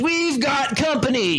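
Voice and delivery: lightly voiced gunman spotting enemies; gruff warning. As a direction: call out a warning to the group. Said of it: "and Frank says".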